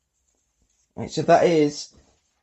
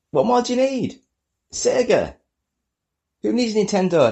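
higher, excited Sega cheer; excited fanboy; 9 semitones higher than usual. Acted. Enthusiastic Sega fan shout.

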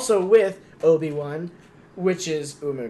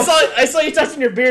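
laughing; 8 semitones higher, 9 dB louder than usual. Real, not acted. Laughing tease.

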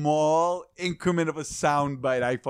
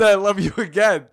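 mock exasperation; 6 semitones higher, 9 dB louder than usual. Retelling dad's repeated texts.

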